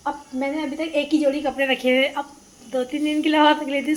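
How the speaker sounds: retold protest to the family that she packed one set of clothes; playful exclaim. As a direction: call it out to the family from the doorway. Announcing at her door to family.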